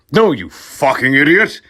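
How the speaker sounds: a hammed-up furious shout. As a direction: yell it at him. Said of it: "I shouted".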